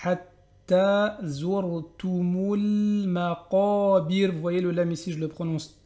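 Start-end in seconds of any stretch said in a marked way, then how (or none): 0.0-4.3 chanted Hatta zurtumul maqabir, very slow; drawn-out chanted verse; slow recitation. Shown as a demonstration. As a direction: recite it like a Quran reader. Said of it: "prononcez la dhamma comme il faut".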